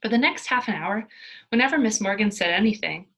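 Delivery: ordinary delivery, nothing marked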